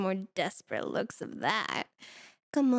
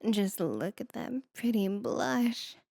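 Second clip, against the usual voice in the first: ordinary delivery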